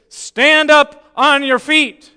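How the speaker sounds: loud, high, slow ringing command. Retold or imitated. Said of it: "quite loudly".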